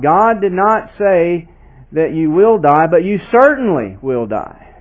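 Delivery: "certainly" pressed firmly; stress on certainly. Shown as a demonstration. Preacher contrasting the two warnings, firm stress. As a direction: nail down "certainly". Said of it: "not as precise".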